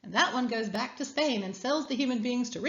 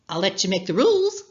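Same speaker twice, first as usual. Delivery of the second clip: Coyote calls out, a little louder; crying out; 7 dB louder than usual. Acted. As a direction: call it out eagerly, a bit louder. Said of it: "cries Coyote".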